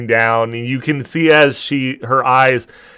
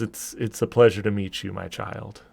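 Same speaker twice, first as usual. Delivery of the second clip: divine goddess voice; 4 semitones lower, 9 dB quieter than usual. Acted. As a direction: speak gently down to a child. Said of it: "And she goes".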